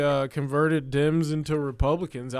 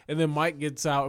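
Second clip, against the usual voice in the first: usual speaking voice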